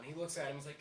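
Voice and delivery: normal talking voice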